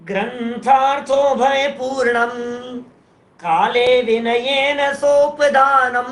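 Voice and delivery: slow, drawn-out verse recitation on a level tune; careful Sanskrit reading. Shown as a demonstration. Teacher proving Hindi readers can read Sanskrit, slow recitation. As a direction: recite each syllable long and steady. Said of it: "सब तो पढ़ रहे हो आप".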